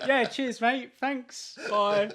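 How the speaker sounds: sarcastic brush-off. Retold or imitated. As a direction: brush him off with fake cheer. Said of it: "And I'm like".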